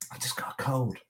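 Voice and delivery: ordinary talk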